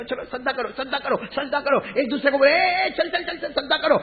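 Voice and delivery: high, hurried-sounding chivvying; high-pitched eager chatter, not shouted; acted eager urging. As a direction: play worshippers egging each other on. Worshippers urging each other on.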